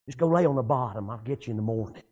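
low, hushed word to the fish; mock whisper, deep and quiet; retold whisper. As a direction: drop low and soft, as if secretly instructing. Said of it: "he whispered, I just seen some whispers".

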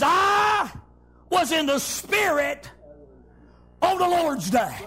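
slow, drawn-out scripture declaration; preacher stretching out a Bible line; preacher's proclamation. Retold or imitated. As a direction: proclaim it phrase by phrase, holding the words. Preacher proclaims John's words.

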